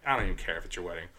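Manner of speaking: low, soft, fast retort; quick muttered dismissal; dismissive. Retold or imitated. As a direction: drop the voice, unimpressed. Bouncer dismissing bride after slap.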